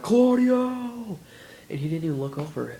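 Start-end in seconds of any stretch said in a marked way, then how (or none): 0.0-1.2 very loud, high call; desperate fan shouting for attention; yelling a name. Retold or imitated. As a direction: bellow his name over the crowd. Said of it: "I was like".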